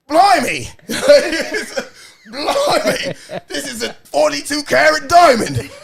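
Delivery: imitating DiCaprio's weird English accent; mock English accent. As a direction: ham up a bad English accent. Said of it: "'cause he has a weird accent".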